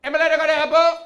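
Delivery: put-on high, loud garbled accent; hostile stranger. Hostile man asking comic for directions, high loud mangled shout. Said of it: "He goes".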